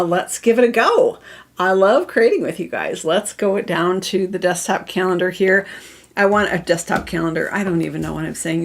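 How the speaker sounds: normal talking voice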